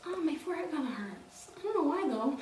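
dazed. Retold or imitated. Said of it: "I was like".

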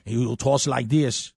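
lightly imitated thick Afrikaans accent, like Arnold; a hint of a heavy Afrikaans accent. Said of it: "They got that real thick accent".

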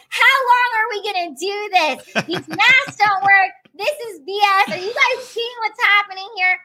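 mock-heroic speech to the room; ranting speech. She rants at the Starbucks crowd, impassioned. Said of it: "break out into the speech".

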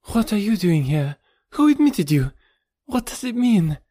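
very high, agitated woman's voice; alarmed cry. A narrator's reading. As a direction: fire startled questions in a high voice. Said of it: "she cried".

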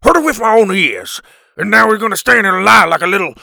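loud, high, ranting fury; furious hollered accusation; spitting anger. A narrator's reading. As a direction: rage at him, gathering speed. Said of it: "Lovekin spat".

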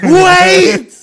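big high laughing yell; yelling wait. Retold or imitated. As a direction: shout it to stop the kick, laughing. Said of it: "I've never yelled this hard".

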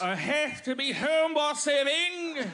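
high, laughing mock Australian "home by seven, mate". Comedian ending his Uber line, told he sounds British. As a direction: finish with a cocky "Mate!". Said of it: "You sound British".

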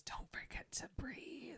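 soft, fully whispered quote; whispered reminder. Retold or imitated. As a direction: whisper it like a secret prompt. Retold quiet reminder to Edward.

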